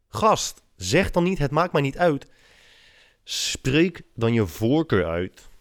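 high, loud, fed-up outburst; exasperated. Real, not acted. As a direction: vent in disbelief. Exasperated rant about Dutch politeness.

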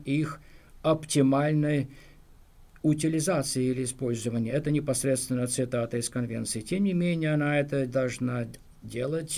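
ordinary talk, nothing marked

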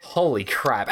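usual speaking voice

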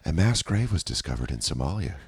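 low, soft, unbothered news delivery; soothing public-radio deadpan; calm radio host. Acted. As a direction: report horror with total serenity. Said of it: "They're always the calmest about the most crazy shit".

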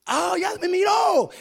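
high, loud, joyful cry; excited shout. Retold or imitated. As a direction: shout with relief that he saw you. Sees the car stop ahead. (grito alegre y agudo)

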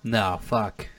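ordinary talk

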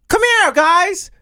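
high, slow sing-song call; mock dog-owner call. Mocking the lady calling her dogs, high sing-song. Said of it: "she goes".

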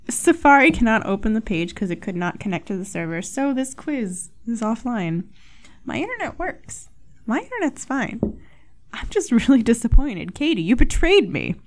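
normal talking voice

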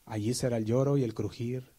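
usual speaking voice

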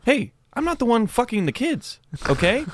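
high-pitched exasperated protest; indignant retort. Acted. Comedian defending joke to offended crowd, indignant.

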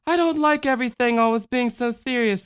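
put-on high childlike voice with a mild sigh; girl weary of seriousness; sighing little girl. A narrator's reading. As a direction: complain wearily like a small girl. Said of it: "the little girl sighed".

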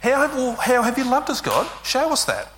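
indignant demand. Retold or imitated. Israel demanding proof from God, indignant. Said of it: "how Israel's responding".

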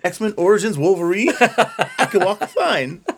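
Wolverine boast, high and laughing; mock proud actor. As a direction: brag about Wolverine, laughing hard. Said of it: "And he's like".

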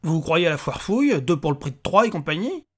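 undisguised irritation at a silly question; open annoyance. A narrator's reading. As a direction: snap back, peeved. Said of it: "ne chercha pas à masquer son agacement".

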